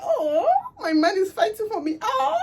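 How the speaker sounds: very high, whimpering swoon; mock swooning. Pretending to be flattered that her man fights for her, with a high swooning whimper. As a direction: gush like a flattered girlfriend. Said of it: "you start feeling like".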